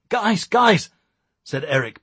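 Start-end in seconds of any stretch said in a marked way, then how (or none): 0.1-0.9 high, loud panicked plea; panicking. A narrator's reading. Eric held at gunpoint by officers, panicking.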